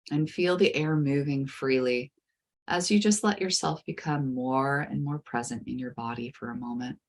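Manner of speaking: soothing guided meditation. Acted. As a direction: ease the listener gently into calm.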